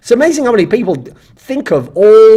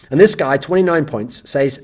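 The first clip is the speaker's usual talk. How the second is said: ordinary talk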